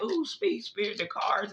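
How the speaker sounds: high, mocking plea to the spirit; a readers' overeager begging, mocked; mock pleading. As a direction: mimic someone begging the cards to speak. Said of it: "why we be like".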